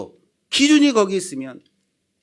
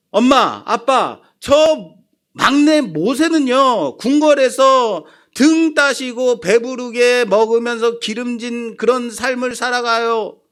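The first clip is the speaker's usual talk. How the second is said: high, slow sibling's complaint; envious complaint; 6 semitones higher, slower than usual. Acted. Moses's siblings complain to mom and dad, high envious whining. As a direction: draw out an envious grievance to the parents.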